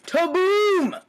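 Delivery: high, loud playful ta-boom; mock explosion. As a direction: burst out like a cannon going off. Repeating the gunshot imitation.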